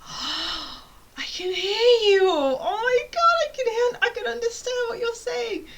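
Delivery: high, loud, near-crying burst of relief; sudden joyful realization. Retold or imitated. Retelling the moment she understood her cousins, overjoyed squeal. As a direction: shriek it like the words finally make sense. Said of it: "I was like".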